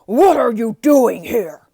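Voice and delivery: very loud furious demand; beast shouting. Acted. Beast bellowing at the trespasser, very loud. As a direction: bellow at the stranger.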